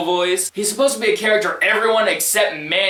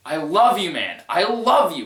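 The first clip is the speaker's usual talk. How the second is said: ordinary talk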